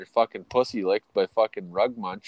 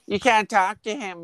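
higher-pitched mocking complaint; mock jealous boyfriend; 5 semitones higher than usual. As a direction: lightly mimic a whiny, controlling boyfriend. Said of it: "He's like".

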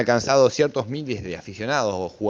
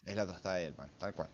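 muttered echo; 4 semitones lower, 19 dB quieter, faster than usual. Real, not acted. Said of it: "estamos comiendo".

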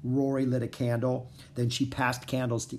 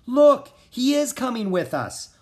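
excited call; 11 semitones higher, 8 dB louder than usual. Acted. Excited girl announcing.

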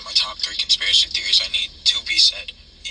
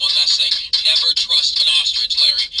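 rising, louder desperate plea; frantic warning; 7 semitones higher, 4 dB louder than usual. Acted. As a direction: cry out a last warning. Caller's frantic final warning.

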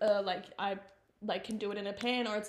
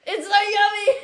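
high, loud, laughing delight; excited squeal; 10 semitones higher, 13 dB louder, slower than usual. Real, not acted. Gushing about jaffles, laughing.

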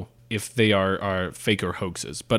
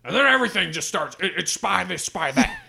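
high, hammed-up outraged pundit; mock a ranting talk show host; 9 semitones higher than usual. Continues mock pundit rant.

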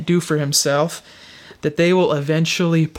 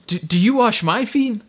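higher-pitched, halting disbelief; taken aback; 5 semitones higher than usual. Acted. Peter questioning Jesus about washing his feet, taken aback. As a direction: stammer the start of a startled question.

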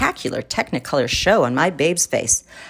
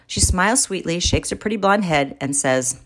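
plain reading voice

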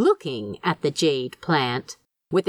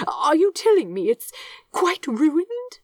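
higher, anxious voice of the angel; 10 semitones higher than usual. A narrator's reading. Aziraphale asking Crowley anxiously about the plant. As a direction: fret over the ruined plant. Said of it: "sounding far more anxious than the matter deserved".

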